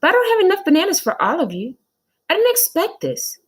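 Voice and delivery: high, fast, loud fluster; flustered teacher. Acted. Teacher flustered about bananas, high and fast. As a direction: rush the apology. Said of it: "said Missus Jules".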